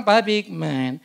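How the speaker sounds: softly voiced doctor, trailing down; doctor's gentle advice. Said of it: "Makanya dokterku bilang". (menirukan dokter, makin pelan)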